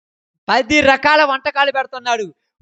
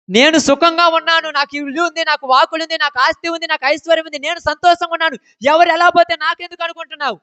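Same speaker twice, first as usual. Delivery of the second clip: smug selfish voice; 7 semitones higher than usual. Retold or imitated. Voicing a selfish listener.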